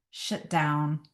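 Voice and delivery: usual speaking voice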